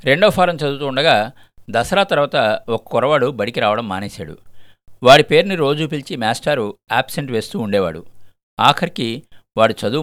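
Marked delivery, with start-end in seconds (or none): none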